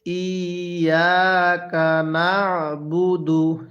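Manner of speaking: slow ইয়াকা না'বুদু with the throat squeezed on না'; throat-pressed ain. Shown as a demonstration. Teacher shows the rule for ain, throat-pressed না'বুদু. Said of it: "গলার মধ্যে চাপ দিয়ে পড়বেন".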